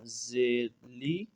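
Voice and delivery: slow drawn-out recitation of letters; spelling drill. Shown as a demonstration. Teacher slowly spelling Quran letters for students, drawn out. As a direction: chant each letter and vowel slowly.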